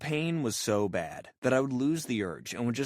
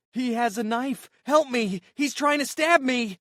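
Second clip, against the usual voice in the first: shrill high screaming for help, much louder; hysterical scream; 13 semitones higher, 8 dB louder, slower than usual. Retold or imitated. Karen screams at the amputee in the coffee shop, hysterical high shriek. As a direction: shriek it like she's in mortal danger. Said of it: "starts screaming".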